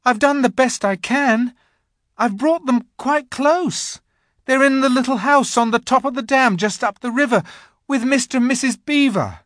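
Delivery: put-on high, loud anxious boy; pleading boy. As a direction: justify yourself to the queen. Edmund pleads with witch.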